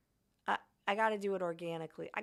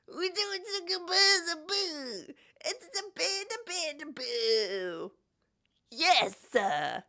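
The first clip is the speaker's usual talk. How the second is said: high, wailing hammed-up impression; impression of John; 8 semitones higher than usual. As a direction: wail the boo like a bad mimic. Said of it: "my impression of John doing an impression".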